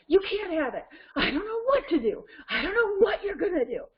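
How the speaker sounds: high-pitched, worked-up wail, imitated; dad matching the meltdown. Dad to daughter flipping out, imitated frantic wail. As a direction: play a dad wailing along with the child. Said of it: "he, you know, he goes".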